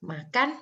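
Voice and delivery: MAKAN pitched high, lifting at the end; rising tune. Shown as a demonstration. Teacher showing students that tune is free, rising tune. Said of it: "antara kamu bilang, contohnya makan".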